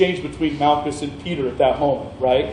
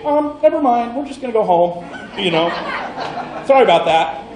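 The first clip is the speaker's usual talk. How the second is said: higher, mock-sheepish backing down; sheepish soldiers; 5 semitones higher than usual. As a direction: play the embarrassed soldiers apologizing.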